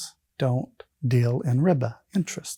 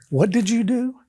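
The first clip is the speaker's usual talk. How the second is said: higher, incredulous imitation of wife; retold shocked question; 9 semitones higher than usual. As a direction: ask it high, as if aghast. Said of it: "her face looked at me".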